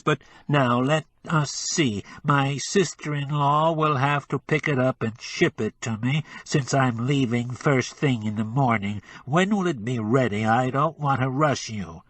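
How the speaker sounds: pinch the sound into the nose; nasal. Acted. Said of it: "with an adenoidal twang".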